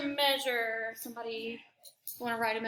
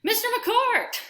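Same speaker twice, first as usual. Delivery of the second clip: higher, calling a student by name; 9 semitones higher, 5 dB louder, slower than usual. Real, not acted. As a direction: call across the classroom. Teacher calls on student.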